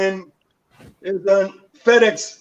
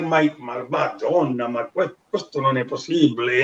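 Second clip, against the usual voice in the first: a hint of Italian women marveling at him; Italian exclaiming; 6 semitones lower, 6 dB quieter than usual. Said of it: "saying all these crazy things".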